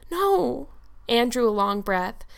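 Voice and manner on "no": high, wondering little answer; amazed. A narrator's reading. Anne learns Diana loves her, amazed.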